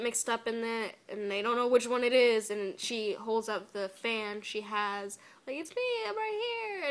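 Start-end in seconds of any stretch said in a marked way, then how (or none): 5.6-6.9 high-pitched imitation of April; small, high mock cry for attention; mock plea. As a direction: squeak it up high to be recognized. Voices April pleading to be recognized.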